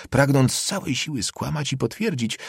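ordinary delivery

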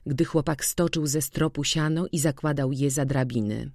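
usual speaking voice

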